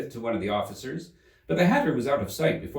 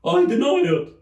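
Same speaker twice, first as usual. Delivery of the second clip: high, much louder denial; indignant March Hare; 14 semitones higher, 9 dB louder than usual. Acted. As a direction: refuse it indignantly.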